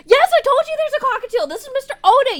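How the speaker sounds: high, loud gleeful shout; excited. Real, not acted. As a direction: show off the cockatiel with delight. Player thrilled seeing favourite character.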